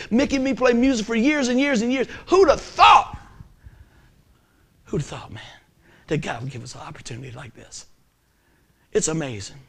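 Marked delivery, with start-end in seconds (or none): 2.3-3.2 high, loud cry of wonder; amazed outburst. Real, not acted. Preacher marvelling over years with friends, loud amazed outburst. 4.8-7.5 softer, lower, awed repeat; hushed wonder. Real, not acted. Preacher reflecting on God's opportunity, soft hushed wonder.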